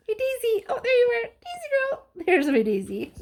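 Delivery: high sing-song pet voice; calling dog. Real, not acted. Teacher cooing to her dog Daisy, high sing-song. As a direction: coo the dog's name.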